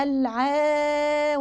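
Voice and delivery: "الع" with the aa stretched far too long; overlong alif. Shown as a demonstration. Teacher shows the overstretched mistake, dragged out. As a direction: drag out the vowel of "الع" excessively. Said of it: "الألف المادية ما ينفعش".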